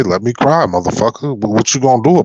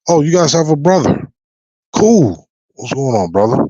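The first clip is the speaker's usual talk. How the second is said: mock friendly shop owner; 6 semitones higher than usual. Mimicking a store owner greeting Tyson.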